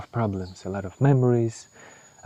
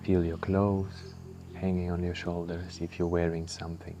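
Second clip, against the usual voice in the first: guided meditation; 5 dB quieter than usual. Acted. Guiding the listener through breath and body scan, calm meditation voice. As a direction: ease the listener inward, unhurried.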